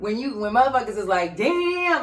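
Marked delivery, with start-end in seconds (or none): 1.4-2.0 loud, high, impressed exclamation; mock amazement. Acting out people hearing a good lie, mock amazement. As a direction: imitate someone hugely impressed, pitched up.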